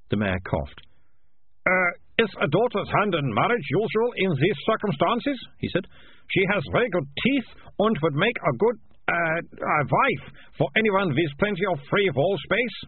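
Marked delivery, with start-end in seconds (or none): 1.6-5.4 high-pitched, fussy official's voice; hesitant mayor. A narrator's reading. Mayor offering daughter's hand to the piper, tentatively. 6.3-12.8 high-pitched, fussy official's voice; hesitant mayor. A narrator's reading. Mayor offering daughter's hand to the piper, tentatively.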